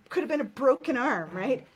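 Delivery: emphatic. Real, not acted. Emphatic example of real pain.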